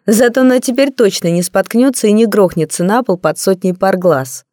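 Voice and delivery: ordinary delivery, nothing marked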